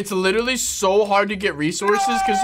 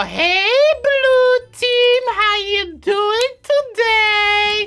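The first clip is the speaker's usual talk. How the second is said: high, very slow sing-song greeting; drawn-out sarcastic hello to blue team; mock-friendly taunt; 14 semitones higher, 3 dB louder, much slower than usual. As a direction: tease the enemy with fake politeness.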